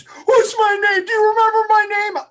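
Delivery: high, loud panicked cry; mock panic. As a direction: imitate frantic trainees yelling down the line. Trainees panic over forgetting names.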